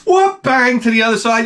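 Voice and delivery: high, playful whoosh; narrating the cursor jumping; mock zip sound. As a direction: make the mouse fly. Mouse flying across screen.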